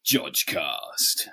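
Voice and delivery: deep slow whispered title drop; dramatic announcer. Acted. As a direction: growl the show name low and slow. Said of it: "I'll try again".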